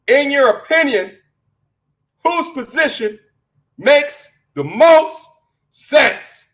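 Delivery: reading that builds to high-pitched yelling; heated, escalating read-aloud; worked-up yelling. Real, not acted. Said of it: "Why are you yelling".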